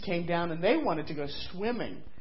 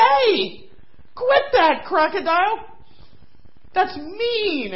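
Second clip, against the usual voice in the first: high hippo voice; 12 semitones higher, 8 dB louder, slower than usual. Acted. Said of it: "She said".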